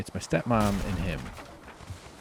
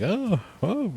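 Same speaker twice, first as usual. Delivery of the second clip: high, breathy "oh, oh" laugh; nervous relieved laugh; 6 dB louder than usual. Retold or imitated. Dad just surviving the falling tree, nervous laugh. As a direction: laugh shakily after a near miss. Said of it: "it kind of just sort of a laugh".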